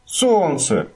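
high, falling cry; shout. A narrator's reading. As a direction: cry the word out. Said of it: "закричал мумитроль".

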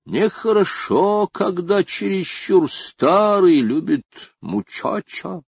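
put-on high-pitched, ponderous old-woman moralizing; thoughtful disapproval, pitched well up; sage pronouncement. A narrator's reading. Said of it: "глубокомысленно заметила она".

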